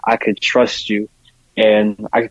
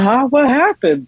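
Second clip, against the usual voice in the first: high, startled huh?; surprised huh; 13 semitones higher, slower than usual. Retold or imitated. Asked to come over, a puzzled startled reply. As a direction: sound caught off guard by the question. Confused reaction to friend's visit.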